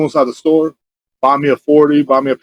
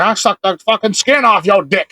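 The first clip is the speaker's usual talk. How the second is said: slow, higher-pitched mock holler; retold yell; 6 semitones higher, slower than usual. Imitating the drugged-out lady before she walks off, mock yell. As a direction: drag it out as a half-hearted yell. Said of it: "she just yelled that".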